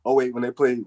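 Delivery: usual speaking voice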